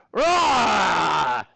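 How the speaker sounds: loud playful monster roar. Acted. Teacher to class, roaring as the beast to start. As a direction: roar to kick off the task.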